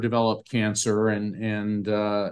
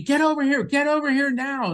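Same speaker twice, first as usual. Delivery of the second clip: high, excited imitation of being waved over; pitched-up hurry-up call, not shouted; urgent summons; 13 semitones higher than usual. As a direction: mimic someone urgently calling a friend over. Retold waving him over urgently.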